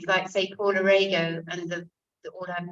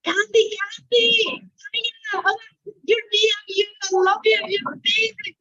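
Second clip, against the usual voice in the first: much higher, louder, starstruck squeal; gushing fan; 8 dB louder than usual. Retold or imitated. Glasgow fans spotting the actress in the street, high starstruck squealing. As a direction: gush like a fan meeting a TV star. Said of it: "people are like".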